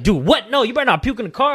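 high, loud, rushed mock alarm; loud, fast, high-pitched refusal; alarmed objection. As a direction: act out friends panicking at the warning. Friends' alarmed reply, acted.

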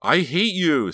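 high, loud furious outburst; scream. A narrator's reading. Sarah furious at Delia, screaming. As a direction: scream it at your sister. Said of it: "Sarah screamed".

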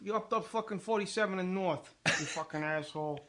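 sweary New York street insult; New York accent. Shown as a demonstration. New Yorker swearing in a taxi skit, New York accent. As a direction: curse someone out like a New Yorker. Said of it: "I do a New York accent".

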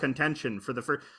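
ordinary talk

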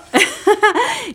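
a high, teary laugh breaking through; emotional laugh. Real, not acted. New mother recalling the moment she became a mom, teary laugh. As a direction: laugh that catches in the throat, close to tears. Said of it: "y yo así lloraba".

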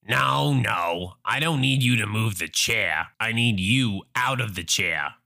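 low, haughty Karen voice; entitled mother. Acted. Entitled mother demands the wheelchair, low and haughty. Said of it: "Entitled mother".

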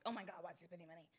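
fast, half-laughing shriek of alarm; panicked wife voice. Retold or imitated. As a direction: blurt it out like you just saw the bank app. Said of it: "say it in that voice again".

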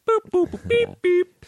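high beeping robot noises; toy robot voice. Pretend. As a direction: beep like a little toy robot. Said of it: "millennial alert".

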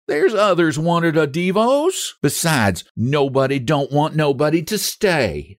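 lightly voiced hurt wife; a hint of a sulking woman; wife's voice. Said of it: "speaking to the wooden stool".

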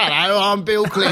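lightly voiced Clinton; Bill Clinton impression. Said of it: "we all get to do a Clinton voice now".

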